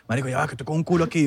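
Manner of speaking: put-on hushed, as if a girl is in the room; dad's hushed aside. Son imitates dad hushing him while he has company, softly.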